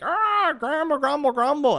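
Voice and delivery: squeaky cartoon grumbling to mock complainers; mock grumbling viewers. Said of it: "they're like".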